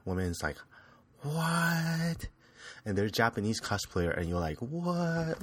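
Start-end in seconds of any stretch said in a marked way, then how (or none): 1.2-2.3 high, startled what; mock surprise. Shocked by hot collector. 4.6-5.4 high, startled what; mock surprise. Shocked by hot collector.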